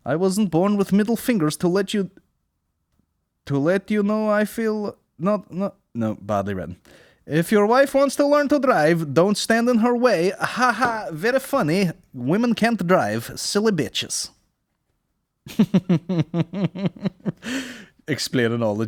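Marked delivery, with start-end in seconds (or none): 0.1-5.8 put-on Russian accent. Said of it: "a Russian accent". 7.3-14.3 high, loud mock Russian accent. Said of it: "a Russian accent". 15.4-17.7 hammy high laugh. Acted. Character laugh.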